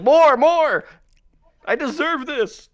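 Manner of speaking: high, loud hammed-up begging; mock pleading. Acts out friend begging for beating.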